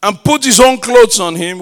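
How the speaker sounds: loud, forceful scripture reading; preaching scripture. Acted. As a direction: proclaim the passage to the whole church. Reads scripture at full force.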